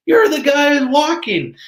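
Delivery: a stranger on the road excitedly recognizing the walker. Retold or imitated. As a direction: call it out like a starstruck passerby. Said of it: "he said".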